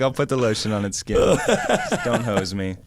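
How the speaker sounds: slow, laughing creepy quote; hammed-up creepy movie line. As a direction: drag the last words out through a laugh.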